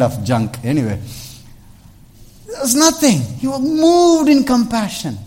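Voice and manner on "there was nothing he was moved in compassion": loud high proclamation; high shouted preaching; preaching climax. Real, not acted. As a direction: proclaim it at full force.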